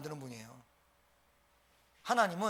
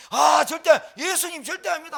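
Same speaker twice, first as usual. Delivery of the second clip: much louder, higher insistent denial; loud protest; 8 semitones higher, 11 dB louder than usual. Retold or imitated. Peter loudly protesting to Jesus, much louder. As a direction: shout it out to swear you never will.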